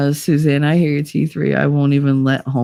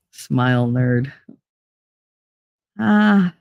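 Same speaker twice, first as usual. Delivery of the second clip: usual speaking voice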